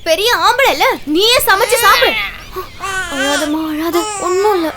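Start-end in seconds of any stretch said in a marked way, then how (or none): 3.2-4.7 put-on softer, lower hush for a baby; calm the crying little one gently; soothing. Sister soothing her crying baby sibling, softly.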